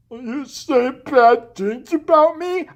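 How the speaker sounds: slow, high, loud caricature; mocked bully. Imitating the big bully's challenge, slow and mocking. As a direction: drag the challenge out in a silly put-on voice.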